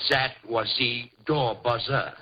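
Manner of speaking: low, slow mock French accent; low, stilted French accent; put-on French accent. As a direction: spell it out slowly in fake French-accented English. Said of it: "at least use a French accent".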